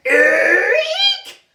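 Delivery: high loud squealing eek; loud high shriek with a laugh; scared shriek. Acted. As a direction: shriek at the bugs. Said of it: "shouted the girls".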